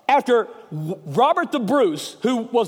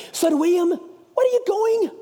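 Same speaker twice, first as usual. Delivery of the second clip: high, drawn-out Scottish call; amateur Scots brogue, pitched up; Scottish accent; 7 semitones higher than usual. Acted. Guys calling after departing William Wallace, high Scottish accent. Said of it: "It's not bad for a redneck".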